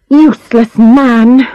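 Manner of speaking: slow, loud scornful scoff; contemptuous. Acted. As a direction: spit it out with contempt. Scornful remark.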